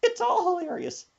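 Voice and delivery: put-on high, giggly old lady voice; grandma laughing. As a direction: squeal it like a grandma who can't stop laughing. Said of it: "She said".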